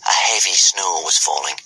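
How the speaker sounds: ordinary delivery, nothing marked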